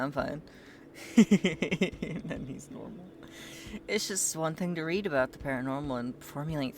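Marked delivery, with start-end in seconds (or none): 1.2-3.0 slow, amused aside through a laugh. Real, not acted. Laughing about the character snapping back to normal. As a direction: chuckle while commenting.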